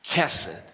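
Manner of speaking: Kesed pitched low, stressed at the start, trailing off; first-syllable accent. Shown as a demonstration. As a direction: lead with a firm first syllable on Kesed, let it fall. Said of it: "Say it with me one more time".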